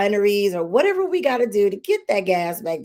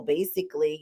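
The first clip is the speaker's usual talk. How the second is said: ordinary talk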